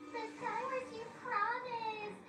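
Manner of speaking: very high, hammed-up tantrum whine; mock a whiny child, tailing down into blahs; childish whining. Girlfriend accuses boyfriend of lying, childish whining. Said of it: "She is acting like a child".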